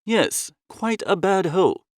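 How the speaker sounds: ordinary delivery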